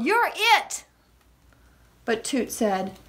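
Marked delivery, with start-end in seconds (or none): 0.0-0.8 high, playful call. A narrator's reading. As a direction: sing out the tag call. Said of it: "Puddle called".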